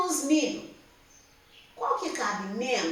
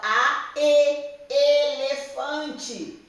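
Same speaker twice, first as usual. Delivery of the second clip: slow spelling out; 3 semitones higher, 5 dB louder, much slower than usual. Shown as a demonstration. Teacher to young pupils, spelling elefante slowly. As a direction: stretch each vowel, then say elefante very slowly. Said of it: "É a segunda vogal".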